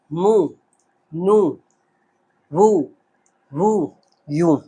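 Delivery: each syllable read slowly and softly, one by one; reading syllables aloud. Shown as a demonstration. Teacher to pupils, reading the syllable chart softly. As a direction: drill the u-sounds one syllable at a time. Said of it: "अब मैं आपको पढ़कर भी बताता हूँ".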